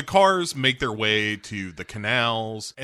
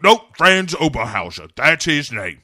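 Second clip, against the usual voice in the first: loud, emphatic mock hero voice; Bond; 3 semitones higher, 7 dB louder than usual. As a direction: insist forcefully, in caricature. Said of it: "And he's like".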